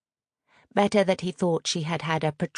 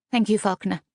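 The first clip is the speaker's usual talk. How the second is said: curt, louder dismissal with a falling close; dismissive thanks; 5 semitones higher, 5 dB louder than usual. A narrator's reading. Mistress dismissing her old butler, curt and final. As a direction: wave the old butler away with the words. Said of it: "Agatha dismissed him".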